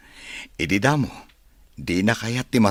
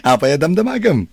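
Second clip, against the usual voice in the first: flustered laugh; 10 semitones higher, 4 dB louder, faster than usual. Acted. Teased about a new love.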